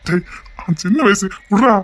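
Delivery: high, loud frustrated rant; exasperated ranting. Acted. Fed-up father ranting at the crowd, high loud exasperation. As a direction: vent loudly at everyone.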